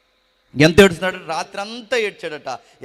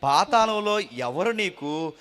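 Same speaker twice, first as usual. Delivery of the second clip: psalm reading; 5 dB louder, slower than usual. Acted.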